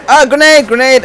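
loud alarmed call; grenade warning yell. Real, not acted.

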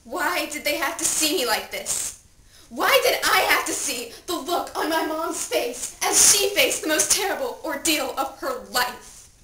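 loud, emphatic anguish; anguished outcry. Acted. Dead teen lamenting the parents' pain, loud anguish. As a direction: demand why in grief.

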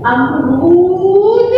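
अमरूद said very slowly; अमरूद, slow and clear; slow syllables. Shown as a demonstration. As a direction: stretch every sound of अमरूद. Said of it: "teacher demo".

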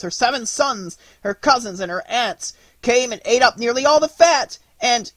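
high, loud, sob-choked lament; a queen wailing to her suffering king; sob-broken cry. Acted. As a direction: wail it through sobs at his feet. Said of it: "cried in a voice broken by sobs".

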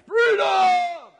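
high, full-throated war cry; Braveheart battle cry. Retold or imitated. Pastor mimics Braveheart to his congregation, roaring war cry. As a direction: belt it out like a rebel leader rallying troops. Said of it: "seen the movie Braveheart?".

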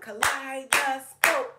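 loud, stretched chant of Kaleidoscope; clapped syllables. Shown as a demonstration. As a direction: beat out each syllable of Kaleidoscope.

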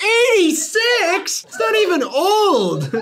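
very high, slow, laughing shock. Real, not acted. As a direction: squeal in disbelief. Shocked at the date.

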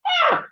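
high mock yell; mock angry cry. Shown as a demonstration. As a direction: burst out with a fake angry yell. Mock angry outburst in sermon.

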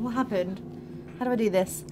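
normal talking voice

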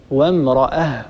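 وامرأة ending on a still h; stop on ha. Shown as a demonstration. Teacher shows the pause on وامرأة. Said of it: "هكذا".

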